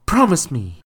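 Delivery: sharp loud mother's order; barked. Acted. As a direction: bark the demand at him. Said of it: "Margot barked".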